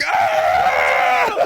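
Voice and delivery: long mock scream; drawn-out crowd shriek, acted; imitated crowd scream. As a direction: scream like a horrified arena. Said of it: "everyone's like".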